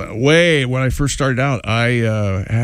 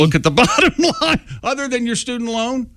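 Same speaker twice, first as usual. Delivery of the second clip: laughing; 9 semitones higher than usual. Real, not acted. Teasing friend about money.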